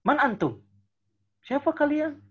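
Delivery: high-pitched, irritated challenge; mock annoyed. Shown as a demonstration. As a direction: act fed up with them, pitch jumping up. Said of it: "Misalnya lagi kesel". (pura-pura kesal, nada tinggi)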